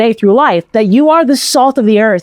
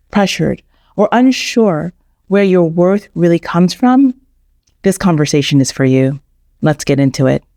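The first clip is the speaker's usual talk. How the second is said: calm, lower scripted intro read; podcast intro; 5 semitones lower than usual. Acted. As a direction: read it like a podcast host opening the show.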